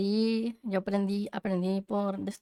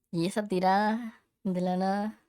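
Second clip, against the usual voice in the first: ordinary talk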